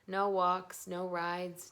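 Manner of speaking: ordinary delivery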